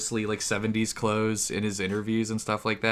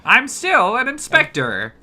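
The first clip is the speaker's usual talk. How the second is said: high, loud, slow cartoon hero; Inspector Magic voice; 12 semitones higher, 6 dB louder, slower than usual. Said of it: "Inspector Magic".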